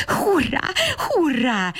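joyful cries, pitched high; happy cheering. A narrator's reading. Babberci cheers happily that his friend came for him. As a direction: cheer it out like a delighted child. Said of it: "kiáltozta boldogan Babberci".

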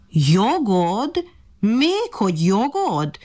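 high, drawn-out incredulous echo; scornful disbelief. A narrator's reading. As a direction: scoff at the very idea, stretching the word. Monkey scoffs at the ant's claim.

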